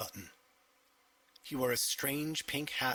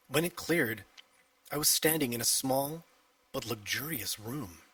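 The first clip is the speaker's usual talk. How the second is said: usual speaking voice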